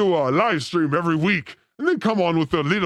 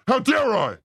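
mock outrage; 3 semitones higher than usual. Mock rage rant.

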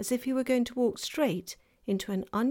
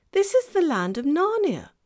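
fawn voice; 9 semitones higher, 8 dB louder than usual. Acted. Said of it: "said the fawn".

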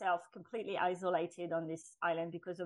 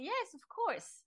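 surprised acceptance; 7 semitones higher than usual. Retold or imitated. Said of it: "to my surprise, I hear myself say".

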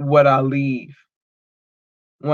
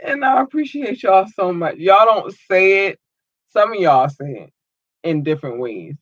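ordinary talk, nothing marked

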